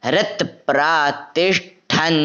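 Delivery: हृत said as ret with a light ri; corrected reading. Shown as a demonstration. Teacher showing the corrected word, ri sound. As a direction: read हृत प्रातिष्ठन with the ri sound. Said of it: "ये हृत ऐसे बोलना है".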